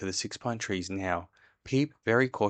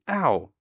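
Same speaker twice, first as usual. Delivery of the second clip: mock ow; 6 semitones higher, 6 dB louder than usual. A narrator's reading. Pooh bumping jar on things.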